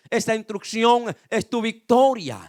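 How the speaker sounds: loud pulpit declaration; forceful, building preacher's cadence; emphatic preaching. Real, not acted. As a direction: hammer the repeated phrase to the congregation. Preacher building on repeated phrase.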